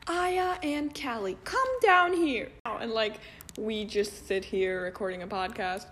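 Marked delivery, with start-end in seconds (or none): 0.1-2.5 teacher calling out. Pretend. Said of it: "Imagine they're already at scene two and be like".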